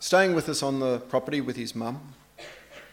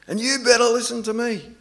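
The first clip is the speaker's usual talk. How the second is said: higher, louder sibling retort; arguing child; 9 semitones higher, 7 dB louder than usual. Retold or imitated. Father mimics the other daughter one-upping her sister, bossy child voice. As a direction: push back like a bossy kid, drawing it out. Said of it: "the other one's going".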